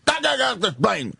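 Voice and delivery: put-on high, obnoxious drunken whine; mock a belligerent passenger's slurred bellyaching; Tony Clifton. Drunk passenger desperate to get off the plane, whiny belligerent yell. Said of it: "It sounded like Tony Clifton".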